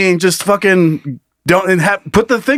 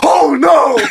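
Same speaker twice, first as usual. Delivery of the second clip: dismayed cry; 11 semitones higher than usual. Pretend. Mock crowd dismay.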